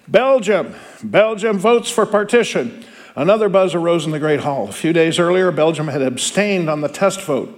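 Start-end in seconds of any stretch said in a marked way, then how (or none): 0.1-2.8 calling the nation out higher, slow; roll call announcer. Acted. Teacher reading the roll call to students, called out higher. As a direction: announce the country to the hall.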